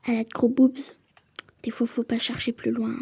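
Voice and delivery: hushed unvoiced aside; whisper. Real, not acted. Player whispering a cheeky aside about the rival.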